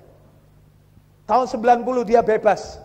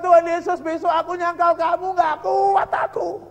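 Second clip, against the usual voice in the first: retold crying prayer; 6 semitones higher than usual. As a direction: cry it out as someone who can't go on. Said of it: "Nangis dia, saudara".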